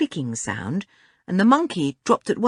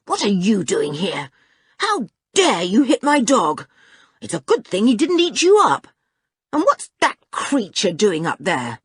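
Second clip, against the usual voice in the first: indignant, raised-voice telling-off; angry scolding; 4 semitones higher, 4 dB louder than usual. A narrator's reading. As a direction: demand an explanation, outraged. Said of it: "she glared at him angrily".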